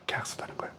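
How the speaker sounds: barely audible whispered repeat; whispered echo. Shown as a demonstration. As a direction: breathe the words again as a secret. Whispered echo of previous line. (속삭이듯 되뇌며)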